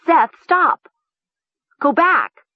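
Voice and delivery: high, loud, urgent shout; urgent high-pitched cries; crying out. A narrator's reading. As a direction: shout in alarm to the driver. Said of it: "she cried".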